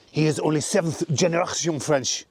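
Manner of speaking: hammed-up French accent. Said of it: "French accent".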